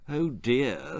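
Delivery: soft, small reply; quiet dismay. Acted. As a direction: say it meekly. Boy dismayed by the taunt.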